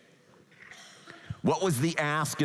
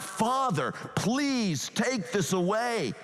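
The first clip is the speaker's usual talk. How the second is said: mild cry out; 8 semitones higher, slower than usual. Retold or imitated. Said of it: "Jesus cries out".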